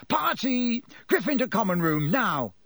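high, rousing announcement; excited calling out. A narrator's reading. George fighting his way over, calling out excitedly. George calls everyone to party.

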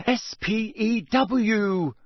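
high, loud, heated spelling; heated, letter-by-letter correction; hot. A narrator's reading. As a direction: snap out each letter slowly and indignantly. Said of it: "said Hermione hotly".

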